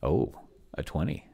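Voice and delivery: normal talking voice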